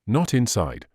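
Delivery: plain reading voice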